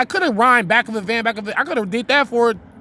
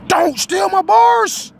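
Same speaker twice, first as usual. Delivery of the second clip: mock shout; 10 semitones higher, 4 dB louder, slower than usual. Real, not acted. Jokingly warns viewers off his bars.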